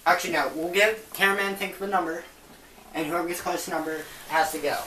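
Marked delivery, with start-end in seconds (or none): none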